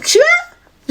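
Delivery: comic high-pitched suspicion; mock suspicious reply. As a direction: pitch it way up like a wary girl. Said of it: "Dès qu'elle va répondre".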